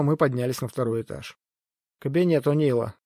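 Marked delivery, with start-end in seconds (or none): none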